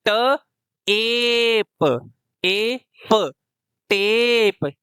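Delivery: ट, एप, टेप sounded out with a long ए; long a blending. Shown as a demonstration. Teacher shows how magic e turns टैप into टेप. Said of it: "ई तो silent है".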